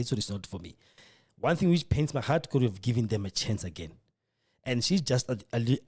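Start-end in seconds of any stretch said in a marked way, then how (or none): none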